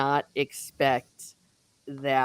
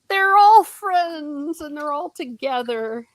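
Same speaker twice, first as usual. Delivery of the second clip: high, drawn-out fan gush; 9 semitones higher, 4 dB louder, slower than usual. Retold or imitated. Reader gushing over the reunited friends, delighted. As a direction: start high and loud, melt down slowly.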